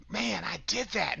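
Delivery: a sigh-of-relief statement, falling at the end; relieved. Shown as a demonstration. Speaker showing listeners how to credit themselves, relieved. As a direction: marvel in relief at getting through it. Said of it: "with relief".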